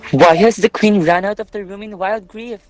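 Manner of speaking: loud, alarmed outcry; alarmed question. Acted. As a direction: ask it loudly, urgently. Queen has fled in grief.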